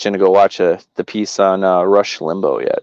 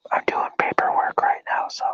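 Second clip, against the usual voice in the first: whispering; 10 dB quieter than usual. Real, not acted. Whispered aside to caller.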